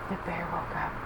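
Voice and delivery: hushed gasp. Acted. Dramatic whisper to kids.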